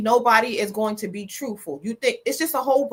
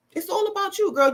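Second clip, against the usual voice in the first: sassy high; 8 semitones higher than usual. Acted. Sassy aside to viewer.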